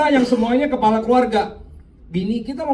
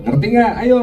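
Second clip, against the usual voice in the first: ordinary delivery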